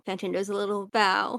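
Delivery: usual speaking voice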